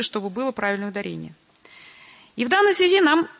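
usual speaking voice

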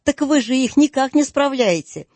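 high, lively exclamation; bright exclaiming protest. A narrator's reading. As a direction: throw it back at him with a rise. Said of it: "воскликнул племянник".

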